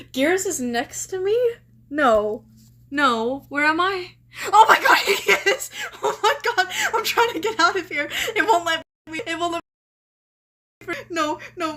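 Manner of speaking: loud, laughing panic as the game traps her; frantic scream-laugh trying to escape; panicked laughing. Real, not acted. Gamer stuck next to a monster, panicked laughing.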